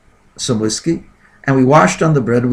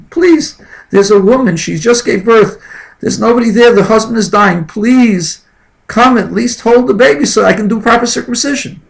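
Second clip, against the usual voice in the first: crying plea; 9 semitones higher, 5 dB louder than usual. Retold or imitated. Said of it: "So I started crying".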